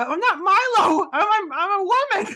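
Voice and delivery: mock squeaky high voice, laughing through it; half-laughing falsetto insistence; high-pitched protest. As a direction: pitch way up to sound like a woman. Insisting to debt collector she's a woman.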